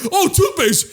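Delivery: very high silly voice, laughing through it; laughing mockery. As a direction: squeal it out mid-laugh like a dope who'll eat anything.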